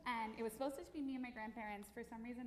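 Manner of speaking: usual speaking voice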